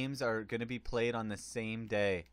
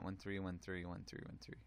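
much softer, fast rattled-off numbers; fast mumbled repeat; 4 semitones lower, 13 dB quieter, faster than usual. Real, not acted. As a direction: mumble it fast under your breath. Mocks own repetition.

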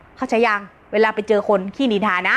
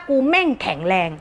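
firm self-affirmation. Shown as a demonstration. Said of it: "มันคิดว่า".

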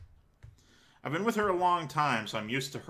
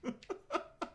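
silent wheezing laugh; 13 semitones higher, 9 dB quieter than usual. Real, not acted. Breathless laugh.

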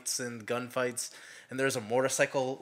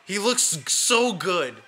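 gushing; 6 semitones higher, 7 dB louder, slower than usual. Real, not acted. Gushing over the actor.

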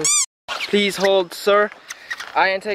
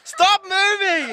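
laughing scared plea; 11 semitones higher, much slower than usual. Real, not acted. Scared on swaying bridge.

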